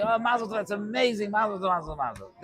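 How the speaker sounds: mock-excited Mazel Tov; feigned delight. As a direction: act thrilled as if hearing the news for the first time. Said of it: "But I would say".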